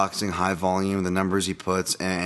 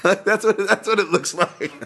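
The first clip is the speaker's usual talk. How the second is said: high, loud laughter through the words; cracking up at the comparison; bursting laughter; 10 semitones higher, 4 dB louder than usual. Real, not acted. Laughing at own joke.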